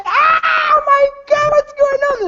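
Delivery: very high horrified scream; panicked girl. Acted. Girl watching her friend shatter, horrified high shriek. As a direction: shriek in horror, drawing out the first words. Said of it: "And she's like".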